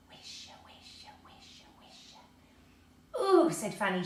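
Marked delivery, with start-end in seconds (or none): 0.1-3.2 slow, secret whisper; a forest whispering secrets; whispering trees. A narrator's reading. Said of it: "whispered secretly to one another". 3.2-3.5 high, loud thrilled ooh; girl thrilled by the magic wood; shivering with delight. A narrator's reading. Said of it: "shivering with delight".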